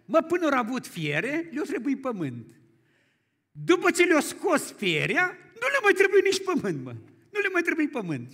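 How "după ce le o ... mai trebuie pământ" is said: emphatic preaching. Acted. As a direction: hammer the moral home from the pulpit. Preacher drives home his point.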